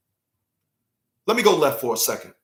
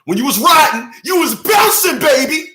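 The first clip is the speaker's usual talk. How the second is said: much higher and louder, bouncing with energy; hyped shout; 10 semitones higher, 5 dB louder than usual. Acted. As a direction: whip the crowd up on bouncing, baby. Preacher hyping the crowd.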